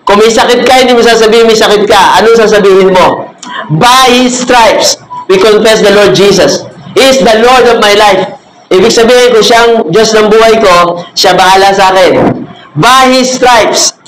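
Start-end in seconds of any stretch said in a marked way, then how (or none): 3.7-5.0 drawn-out quote. Acted. Pastor quoting healing verse. 12.8-14.0 drawn-out quote. Acted. Pastor repeating healing verse.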